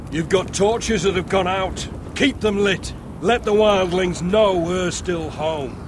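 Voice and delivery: calling out orders. Acted. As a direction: order the watch from a distance, slowly and firmly. Officer calling orders across camp.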